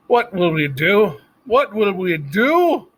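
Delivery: high, loud, slow wail. A narrator's reading. Scene: Cook wailing in despair to Louise, loud. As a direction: wail it out, helpless. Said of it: "wailed Cook".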